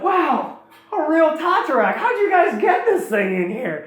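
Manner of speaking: high, wide-eyed mock wonder; amazed. Acts Qualis marvelling at the dragon.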